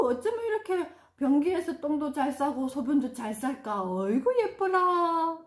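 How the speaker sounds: high, loud, doting praise; gushing praise. Shown as a demonstration. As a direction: overdo the delight for the child. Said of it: "자, 보세요". (잘 먹는 아이를 과장되게 칭찬)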